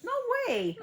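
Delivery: very high, loud squeal; exaggerated high-pitched wail of refusal; mock tearful disbelief. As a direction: squeal it in hammed-up protest. Mock-distraught reaction to crying video.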